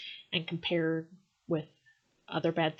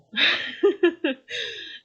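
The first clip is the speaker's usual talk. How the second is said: high, loud bursting laugh; sudden loud real laugh; burst of laughter; 12 semitones higher, 7 dB louder than usual. Real, not acted. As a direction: crack up at the poem.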